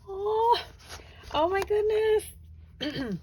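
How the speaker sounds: high, slow, tearful oh my goodness; be genuinely moved to the edge of tears; choked up. Real, not acted. Crafter reading a sweet butterfly sentiment, choked up. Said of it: "Okay, I'm gonna start crying".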